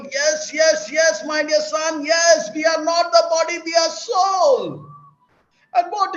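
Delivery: loud, high, ringing yes, slowing at the end; joyful shout. Acted. Father rejoicing at his son's insight, loud and high. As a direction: proclaim it like a proud father. Father answers son triumphantly.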